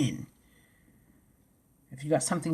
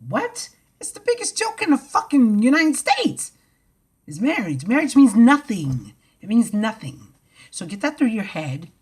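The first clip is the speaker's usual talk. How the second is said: loud scornful yell; angry shout; 5 semitones higher, 8 dB louder than usual. Real, not acted. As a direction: shout your contempt for it. Shouting about marriage.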